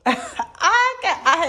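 a bright burst of laughter into the words; laughing at herself before climbing in. Real, not acted. Laughing at herself before climbing into the tub.

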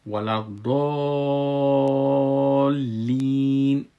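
ولا الضالین loud, the "daa" held very long; long madd. Shown as a demonstration. Teacher shows the required lengthening, ولا الضالین hugely drawn out. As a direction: chant ولا الضالین, sustaining the long vowel. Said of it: "پنج الی شش حرکت".

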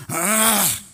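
a loud, bratty groan of protest; mock kid's whiny groan; imitated groan. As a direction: groan like a sulky kid. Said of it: "your kid looks at you and goes".

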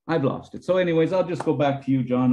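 ordinary talk, nothing marked

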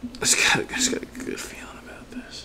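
hushed, voiceless repeat; whisper. Pretend. Gamer repeating a hopeful line, secretive whisper. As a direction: whisper it as if to oneself.

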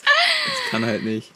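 very high squeaky laughing; laughing disbelief. Real, not acted.

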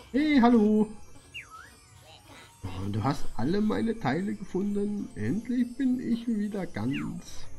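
high, happy creature voice; high character voice. Pretend. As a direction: chirp it like a tiny helper.

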